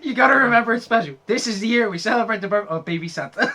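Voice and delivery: high, laughing, rushed quote. Retold or imitated. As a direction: read the line through laughter. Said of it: "He goes".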